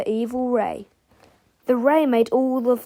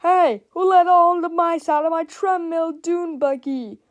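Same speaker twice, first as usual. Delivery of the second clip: high, loud put-on voice; outraged shout; 7 semitones higher, 5 dB louder than usual. A narrator's reading. Inventor finds mice gone from the dune buggy, shouting. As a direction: shout an angry accusation. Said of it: "people shouting everything from".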